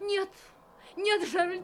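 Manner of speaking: high frightened plea; panicked cry. Acted. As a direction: beg in panic. Wife panicking.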